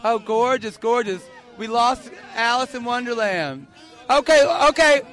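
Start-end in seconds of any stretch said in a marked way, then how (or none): 4.1-5.0 loud, raised, drawn-out introduction; announcing a guest. Real, not acted. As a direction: call out the guest's grand title, loudly. Host announces a festival guest.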